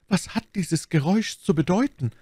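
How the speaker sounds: high, anxious questioning; alarmed question. A narrator's reading. Bonacieux asks Milady about the noise, alarmed. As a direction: ask it high, fearing the answer. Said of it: "rief Madame Bonacieux".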